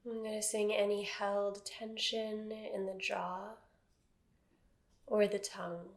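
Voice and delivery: calm, even meditation voice with long silences; guided meditation. Acted. Teacher settling a seated class into body awareness, guided meditation. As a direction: guide them gently into stillness, phrase by phrase.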